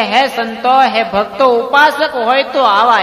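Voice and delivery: slow, loud, ringing announcement to the assembly; slow, loud proclamation before the gathered devotees. Acted. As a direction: call it out slowly and loudly to all the saints.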